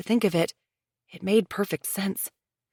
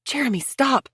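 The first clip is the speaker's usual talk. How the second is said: higher, sharp order; snapped; 8 semitones higher than usual. A narrator's reading. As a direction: stop him short. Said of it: "Leona snapped".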